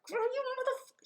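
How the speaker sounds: mock rage. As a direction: cut off mid-curse, high and angry. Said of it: "I was like".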